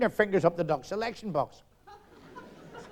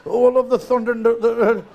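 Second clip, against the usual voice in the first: much louder, rapturous cry of pleasure; hammed-up ecstasy; 6 semitones higher, 11 dB louder than usual. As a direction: punter swept away by the storm. Ecstasy building with thunder.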